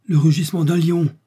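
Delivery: loud, high cry of alarm; alarmed exclamation. A narrator's reading. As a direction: exclaim at the danger. Joey reacts to a roar.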